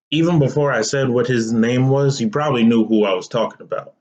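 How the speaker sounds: ordinary delivery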